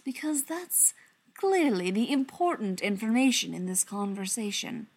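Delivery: ordinary delivery, nothing marked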